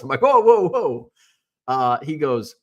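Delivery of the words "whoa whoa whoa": high, loud protesting whoas; raised-voice mock objection; mock alarm. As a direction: act out comically alarmed backing off. Reacts to an awkward innuendo.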